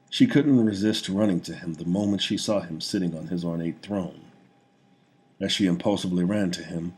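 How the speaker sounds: plain reading voice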